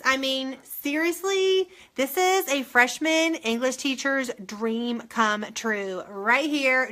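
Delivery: usual speaking voice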